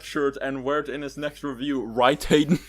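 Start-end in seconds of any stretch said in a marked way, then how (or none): 1.8-2.6 loud teasing warning; mock threat. Real, not acted. Jokingly threatening the co-host about the shirt, loud. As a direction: lean on Hayden like a threat. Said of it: "Like how you say this like it's a threat".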